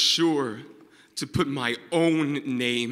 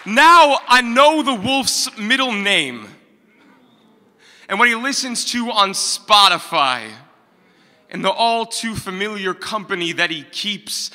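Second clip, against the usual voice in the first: raised-voice slam-poem climax subsiding; impassioned cry, easing; 5 semitones higher, 8 dB louder than usual. Acted. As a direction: cry it out, then let the force drain gradually.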